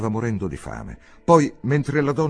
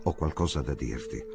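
soft, lowered voice; under his breath; 5 semitones lower, 5 dB quieter than usual. Acted. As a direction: say it low so only they hear. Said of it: "gli disse sottovoce". (a bassa voce, sottovoce)

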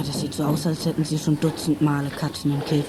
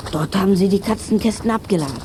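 normal talking voice

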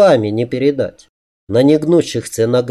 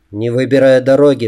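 plain reading voice

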